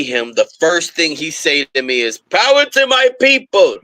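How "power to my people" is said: imitating a Russian clerk, high and loud; Russian accent, pitched way up; strong Russian accent. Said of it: "in a strong Russian accent".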